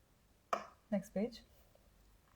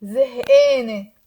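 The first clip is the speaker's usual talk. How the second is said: زهقانة with the final vowel as a Mount Lebanon A, said louder; Mount Lebanon accent; 10 dB louder than usual. Shown as a demonstration. Teacher showing students the Mount Lebanon pronunciation. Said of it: "Mount Lebanon style".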